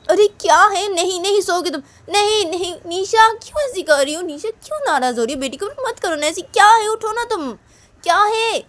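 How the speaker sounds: very high, loud pleading; insistent friend. Acted. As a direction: refuse to let her sleep. Manisha shakes Nisha.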